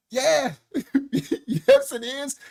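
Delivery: high laughing yes, breaking up; laughing insistence. Retold or imitated.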